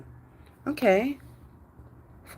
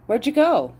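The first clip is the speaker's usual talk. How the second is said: calling out; 7 semitones higher than usual. Real, not acted. Looking for lost dog.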